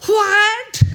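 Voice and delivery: a loud, high, rising What; preacher repeating the booming What; emphatic shout again. Shown as a demonstration. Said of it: "can I do it one more time".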